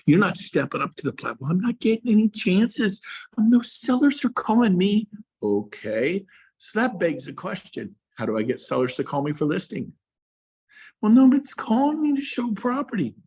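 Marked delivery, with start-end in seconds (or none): 1.4-5.1 high, whiny complaining agent; whiny excuse. Pretend. Agent making excuses to the coach, high whine. 11.0-13.1 high, whiny victim's complaint; whiny excuse. Pretend. Agent complaining to the coach, high whine.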